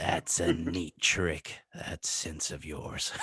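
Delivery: deep, slow, quiet villain impression; Willem Dafoe Goblin. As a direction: drag it out low and soft as the goblin. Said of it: "Yours is a bit too deep".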